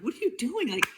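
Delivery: high, exasperated self-scolding; scolding herself. Retold or imitated. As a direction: snap at your own frantic self from above. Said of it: "look down, like".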